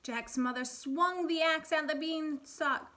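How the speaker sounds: put-on lively storybook reading for kids; animated read-aloud for first graders; reading to children. A narrator's reading. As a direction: read it like a picture book at storytime. Teacher reads fairy tale aloud.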